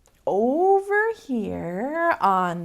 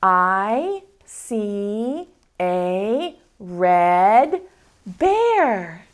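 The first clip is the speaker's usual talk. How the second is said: drawn-out, word by word; slow model reading; 2 semitones lower, 4 dB louder, much slower than usual. Shown as a demonstration. Teacher modeling slow word-by-word reading. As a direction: model the sentence slowly for kids. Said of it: "Let me hear you".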